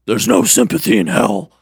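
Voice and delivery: put-on devil voice; Satan's demon voice. Satan refusing the dreamer sympathy, put-on demon voice. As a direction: answer coldly as a cartoon devil. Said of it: "in a deep voice".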